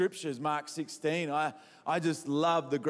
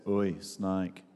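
deadpan; 8 semitones lower than usual. Retold or imitated. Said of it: "with that much enthusiasm in his voice".

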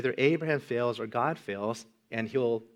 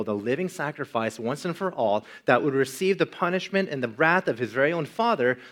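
louder, impassioned sermon delivery; preaching; 2 semitones higher, 5 dB louder than usual. Acted. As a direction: drive the point home from the pulpit. Sermon builds to a climax.

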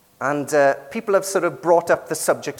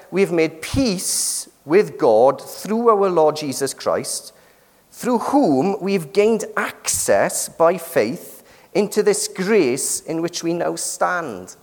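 slow, measured Bible reading; scripture reading; 4 semitones higher, slower than usual. Acted. Preacher reads the Bible passage to the congregation, slow and measured. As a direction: read the verse slowly, weighing each phrase.

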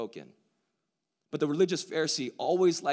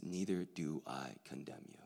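much softer and lower, tender; tender hush; 8 semitones lower, 9 dB quieter than usual. A narrator's reading. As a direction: give it to her gently, barely above a breath. Climax line spoken softly.

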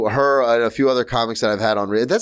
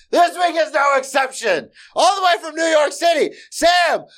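booming, much higher guest introduction; announcer shout; slower than usual. Acted. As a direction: call the name out like a ring announcer.

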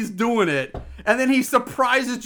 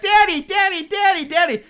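put-on pestering child; 9 semitones higher than usual. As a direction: shout it like a nagging toddler. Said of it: "And I'm like".

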